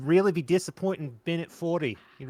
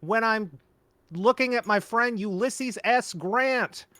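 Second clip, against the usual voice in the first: high, slow cartoon voice; cartoon character voice; 6 semitones higher, slower than usual. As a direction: drag it out high and silly. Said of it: "like a Rick and Morty character".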